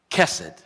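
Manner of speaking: Kesed, low and loud, stressed on the first syllable; first-syllable accent. Shown as a demonstration. Preacher modelling the Hebrew word, Kesed with first-syllable accent. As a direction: hit the start of Kesed and let the end fall. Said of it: "the accent's on the first syllable".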